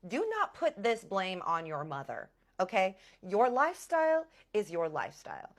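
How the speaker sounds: acted indignant lecture; stern scolding. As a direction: lay down the law word by word. Acted scolding, blame on mother.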